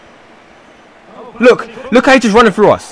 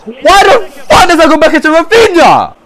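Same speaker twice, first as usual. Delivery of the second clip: raised, high-pitched disbelief; real frustrated yell; exasperated outburst; 7 semitones higher than usual. Real, not acted. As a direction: protest angrily at a bad pass. Exasperated at teammate's bad back pass.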